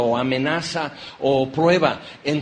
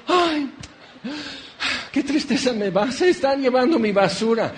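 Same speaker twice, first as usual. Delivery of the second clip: high, hammed-up sigh over the garbage; mock-tragic wail, pitched high; mock grief; 7 semitones higher than usual. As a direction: pretend to mourn the trash truck. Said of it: "y nadie dice".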